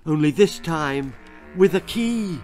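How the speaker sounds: ordinary delivery, nothing marked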